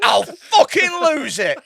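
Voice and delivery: slow, high, shouted punchline; mock furious. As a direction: draw out the yelled threat.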